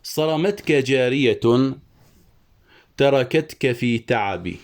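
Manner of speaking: slow, measured poetry reading; verse recitation. Shown as a demonstration. Teacher quoting the poet's example, slow recitation. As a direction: recite it slowly as metred verse. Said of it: "قال الشاعر".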